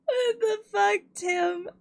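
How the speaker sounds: high, cracking with laughter; laughing disbelief. Real, not acted. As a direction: squeal it through laughter.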